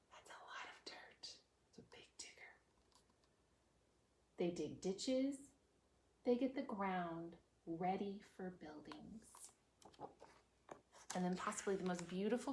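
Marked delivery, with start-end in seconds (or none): 0.1-2.5 whisper. Real, not acted. Whispered aside to kids. 6.2-9.2 whisper. Acted. Whispered reading.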